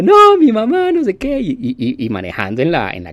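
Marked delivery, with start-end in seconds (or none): 0.0-1.4 very high, loud mock wail; imitating sobbing driver. Driver on the phone about his dead mother, loud mock wail. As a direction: cry out like a grieving man, pitched way up. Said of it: "Y el tipo obviamente llorando".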